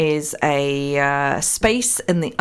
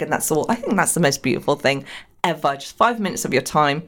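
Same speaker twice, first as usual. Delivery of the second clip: ordinary delivery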